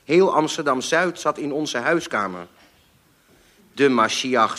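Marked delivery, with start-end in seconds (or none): none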